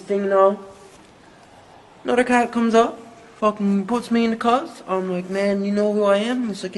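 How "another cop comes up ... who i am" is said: loud and high, still wound up; excited telling. Retold or imitated. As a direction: keep the fight energy going. Fight story climax continues.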